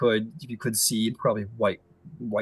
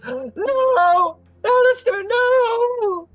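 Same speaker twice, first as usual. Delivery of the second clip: high, drawn-out wail of grief; loud, stretched, horrified cry; anguished scream; 10 dB louder, much slower than usual. Acted. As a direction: scream it out over the fallen. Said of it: "Uh, she, she screams".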